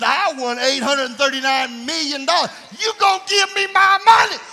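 loud, high mock demand for the money; demanding shout. Lottery winner to the office, shouting. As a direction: yell it like busting through the door. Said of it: "and said".

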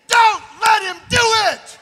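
loud, high, slowed exhortation; preaching climax. Acted. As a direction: land the warning hard.

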